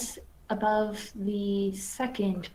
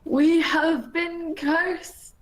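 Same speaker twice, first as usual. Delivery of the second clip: slow, high, loud lament; moaning; 8 semitones higher, 5 dB louder, much slower than usual. Acted. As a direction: drag it out in a moan. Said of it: "he moaned".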